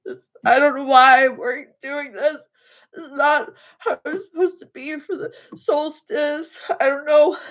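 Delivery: high, tearful and breaking; sobbing out the words for real, high and loud; crying. Real, not acted. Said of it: "I started bawling my eyes out".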